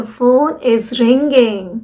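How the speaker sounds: very slow, careful model phrase; slow correct model. Shown as a demonstration. Teacher giving the correct phrase very slowly. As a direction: stretch it out for learners to copy. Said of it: "नहीं".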